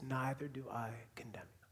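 soft breathy whisper of grace; hushed tender whisper. Acted. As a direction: breathe it like a secret. Jesus's words, repeated refrain.

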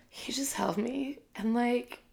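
breaking into a whisper, choked up; emotional whisper. Real, not acted. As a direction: whisper it as emotion catches the throat. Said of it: "I'm getting emotional now".

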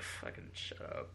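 unvoiced, slow muttered dismissal; quiet fed-up whisper; whispered retort. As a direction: breathe it out under your breath. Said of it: "And I was just like".

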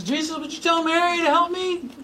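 high, whiny complaint to Jesus; complaining Martha. Acted. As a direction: whine it up high like a put-upon sister. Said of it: "she finally complains to Jesus".

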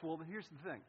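normal talking voice

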